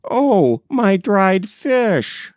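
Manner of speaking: high, slow dismay; dismayed cry. Acted. Grandmother on his back remembering her fish, high dismayed cry. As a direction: wail over the forgotten fish.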